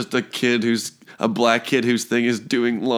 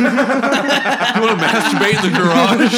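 laughing; 9 semitones higher, 4 dB louder, slower than usual. Pretend. Mocking cousin with laughter.

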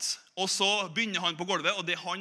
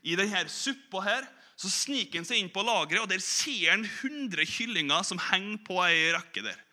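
ordinary delivery

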